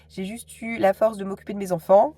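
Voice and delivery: ordinary talk, nothing marked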